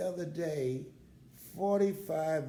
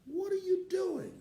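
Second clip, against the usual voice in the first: son's question. Retold or imitated. Son Calvin on the phone.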